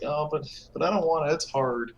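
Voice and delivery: put-on high whiny childish complaint; whiny kid voice. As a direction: whine it like a spoiled kid. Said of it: "And I was like".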